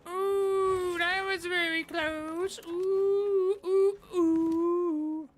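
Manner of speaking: very high, loud excited squeal; high squealing ooh. Real, not acted. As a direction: squeal at the near miss. Near miss in game.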